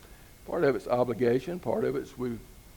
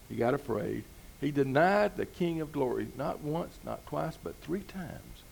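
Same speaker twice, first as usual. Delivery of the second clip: normal talking voice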